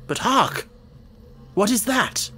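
high-pitched, urgent alert; startled alarm. A narrator's reading. Holmes hears a moan on the moor, startled and high. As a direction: break off in alarm, pitch jumping.

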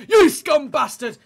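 slow, loud terrace bellow; mock angry shout again. Fan jeering a former rival's player, loud mock shout. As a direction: hammer the insult home. Repeat of the jeer.

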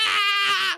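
long frightened scream. Real, not acted. Boy attacked by tiger, long frightened scream. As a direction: scream out in panic. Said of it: "he screamed again".

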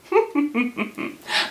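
high little run of chuckles; scheming giggle. Acted. Hortensio plotting to woo his love, scheming giggle. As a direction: giggle over a secret plan.

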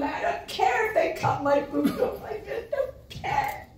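high, choked-up voice on the edge of tears and laughter; real emotion. Real, not acted. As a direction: let the feeling break through, half laughing. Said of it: "I think I'm so quite emotional".

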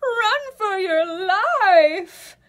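panicked raccoon. Acted. Raccoon warning Birdie of danger, panicked loud wail. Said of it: "Raccoon gives a piece of advice".

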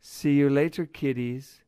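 high, sing-out taunting farewell; mocking. A narrator's reading. Cat escaping by balloon from his pursuers, mocking. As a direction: tease the pursuers from out of reach. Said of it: "mocked Malone".

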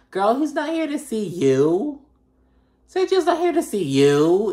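incredulous retort. Acted. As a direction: set them straight with exaggerated emphasis. Acted retort to Lisa's complaint.

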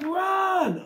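high, loud warthog scream; panicked scream. A narrator's reading. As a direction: scream the alarm at full pitch. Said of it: "screamed Warthog".

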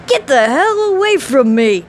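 put-on a boy lashing out in panic; terrified. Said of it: "on a crook of terror".

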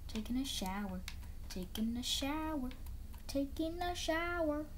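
lazy slow singsong chant; slow sing-song. Pretend. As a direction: stretch it lazily like a little tune. Repeats chant while showering in game.

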